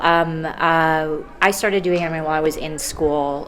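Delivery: normal talking voice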